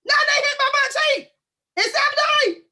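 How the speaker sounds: shrieked way up high, hammed-up; comic high-pitched complaint; high mock wail. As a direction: squeal it like an outraged wife.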